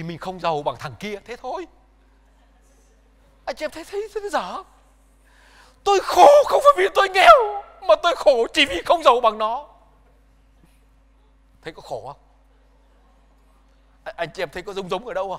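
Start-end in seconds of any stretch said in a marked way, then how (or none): 5.8-9.7 loud, high, fast bitter shout; mock bitter outcry. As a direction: cry it out like an envious man who feels robbed. Preacher mimics bitter complainer.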